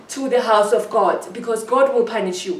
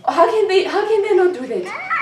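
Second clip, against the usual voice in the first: indignant outburst; 8 semitones higher than usual. Real, not acted. Outraged about his family.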